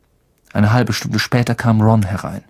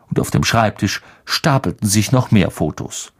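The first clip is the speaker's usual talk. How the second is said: usual speaking voice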